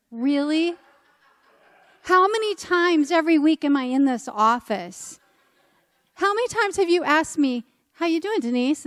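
mock outrage at the leader, raising the voice; indignant. Scolding the RCIA leader about the secret, in loud indignation.

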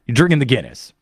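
usual speaking voice

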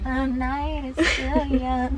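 slow, wailing hammed-up line; mock dramatic drawl. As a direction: stretch it like a theatrical lament. Friend drawls party line, laughing.

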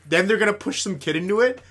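ordinary talk

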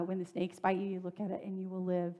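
ordinary delivery

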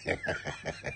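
high squeaky giggle; corny dad laugh. Real, not acted. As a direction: giggle at your own pun. Laughing at own joke.

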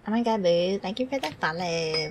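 normal talking voice

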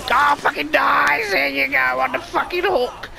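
high, excited triumphant yell; gleeful shout. Real, not acted. Player celebrating as the enemy lands on the hook, elated. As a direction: crow loudly over the win.

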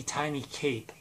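usual speaking voice

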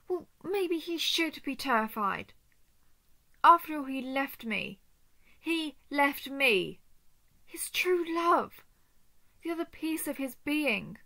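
loud, wounded outburst, leaning on each phrase; bitter anger. Acted. Spurned lover raging that he left her, bitter anger. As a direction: hurl the accusation, hurt and furious.

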